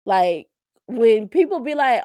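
ordinary talk

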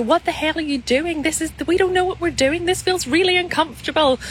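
high-pitched panicky protest at normal volume; mock shouting. Every part of you protesting at the new business, high mock shout. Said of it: "every single part of you shouting at you".

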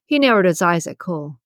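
usual speaking voice

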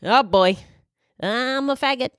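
put-on talk-show host voice, rising high; Ellen. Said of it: "I have heard I sound like Ellen".

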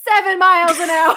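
loud laugh-broken guess; laughing blurt. Real, not acted. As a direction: blurt the answer while cracking up. Laughing at own conversion.

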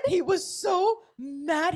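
high, slow, through laughter; laughing. Real, not acted. Wife recounting her husband's rant, slow and laughing. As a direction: giggle it out.

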